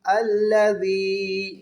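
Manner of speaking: slow, drawn-out tajweed chant; تلاوت کا انداز; Quran recitation. Shown as a demonstration. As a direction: stretch the long vowels like a reciter. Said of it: "کھینچ کر پڑھنا ہے".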